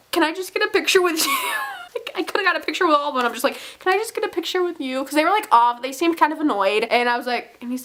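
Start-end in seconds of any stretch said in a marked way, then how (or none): 0.1-1.4 high, timid retold plea; shy fan request. Fan asking Peter for a picture, shy and giddy. 3.8-5.0 high, small retold plea; shy fan request. Fan asking only Peter for a picture, shy.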